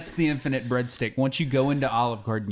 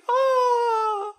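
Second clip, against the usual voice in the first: panicked cry; 4 dB louder than usual. Acted.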